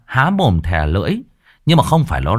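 usual speaking voice

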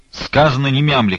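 angry abbess shouting an order. A narrator's reading. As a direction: shout her down, stamping. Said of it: "крикнула игуменья".

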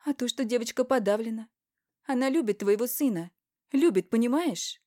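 high, loud, insistent plea; heated appeal. Acted. Wife standing up for the dejected girl, insistent.

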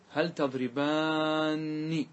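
very slow تضربان with long "aa" and "-nni" ending; emphatic nun added. Shown as a demonstration. Teacher repeating the emphatic form for students, very slow. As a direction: hold the end of تضربان, closing on "nni". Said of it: "فتدخل النون".

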